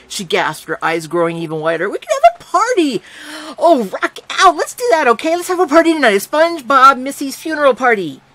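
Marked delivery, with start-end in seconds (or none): 1.9-8.2 high, loud, bouncing with glee; a sugar-rushed little girl planning a party; giddy excitement. A narrator's reading. Little girl squealing to narrator about a party, gleeful and high.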